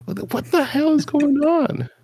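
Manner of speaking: high, put-on baffled voice; mock exasperation. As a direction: squeak it out like a fed-up viewer. Mocking confused viewer.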